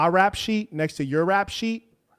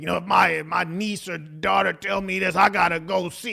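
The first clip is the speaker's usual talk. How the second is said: mock tough guy; 3 semitones higher than usual. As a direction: parody his hothead reaction. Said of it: "you're like".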